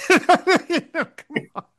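high, squeaky, giggling protest; laughing. Real, not acted. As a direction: laugh your way through come on. Laughing at Winchester's bigotry.

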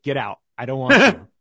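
imitated school head's loud brush-off; retold angry dismissal. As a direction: act out throwing someone out. Retold harsh rejection of an auditioner.

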